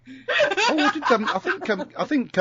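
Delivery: speaking through giggles; laughing. Real, not acted. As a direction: laugh while answering. Laughing while starting to answer.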